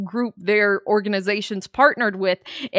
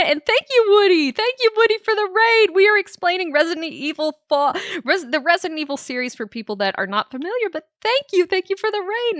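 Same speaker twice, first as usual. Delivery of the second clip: much higher, bright excited thank-yous; 8 semitones higher, faster than usual. Real, not acted. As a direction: gush thanks at the raider. Thanking streamer for raid.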